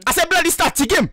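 put-on high, agitated African accent; indignant outburst in a put-on African accent. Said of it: "so you don't have an African accent".

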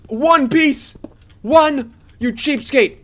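high, loud indignant protest; mock outrage. Real, not acted. Gamer getting one piece of coal, mock outrage. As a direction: exclaim in comic indignation, pitch way up.

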